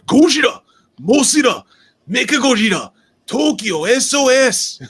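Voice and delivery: loud, high, dramatic trailer call; movie trailer announcer. Retold or imitated. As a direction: belt it out like a monster movie promo. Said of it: "that deep inflection".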